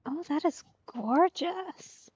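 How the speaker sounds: ordinary talk, nothing marked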